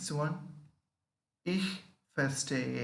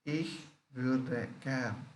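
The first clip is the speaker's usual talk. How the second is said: Ich würde gern said slowly with a fall; slow practice demo; slower than usual. Shown as a demonstration. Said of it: "One second, we take practice".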